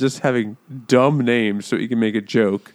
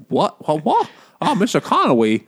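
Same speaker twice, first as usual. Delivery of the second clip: imitating Barbara Walters; 5 semitones higher than usual. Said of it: "And she was like".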